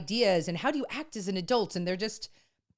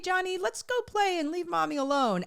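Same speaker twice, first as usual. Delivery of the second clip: higher, kid-like voice imitating a child; 10 semitones higher than usual. Mom quoting her kid to a parent, playful child voice. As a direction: chirp it like a little kid talking to a sibling.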